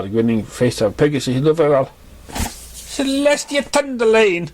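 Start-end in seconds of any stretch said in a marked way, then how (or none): 2.3-4.5 much higher and slow, caught off guard; startled young pony. A narrator's reading. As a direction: squeak it out like you just got scared. Startled pony scolding friend.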